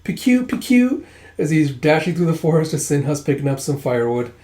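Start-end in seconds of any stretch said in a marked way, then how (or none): 0.1-1.1 much higher, slow little pew pew; cute pew sounds. Retold or imitated. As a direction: chirp it like a tiny cute owl. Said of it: "with his cute".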